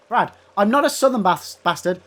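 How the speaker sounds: ordinary talk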